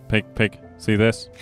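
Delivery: ordinary talk, nothing marked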